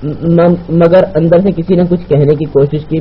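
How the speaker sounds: plain reading voice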